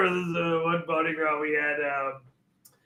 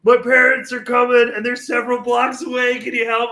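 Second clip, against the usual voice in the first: high, loud panicked friend; freaking out; 6 semitones higher, 7 dB louder than usual. Retold or imitated. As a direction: imitate a panicked plea for help, pitched up. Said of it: "his friend was freaking out".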